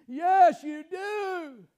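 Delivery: loud, forceful repeat of the answer; emphatic shout. Real, not acted. Preacher answering a doubting listener, loud insistent shout. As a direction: bellow it back at them.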